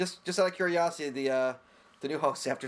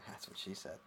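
whispered; 7 semitones lower, 17 dB quieter than usual. Real, not acted. Joke muttered aside.

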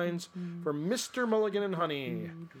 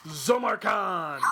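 normal talking voice

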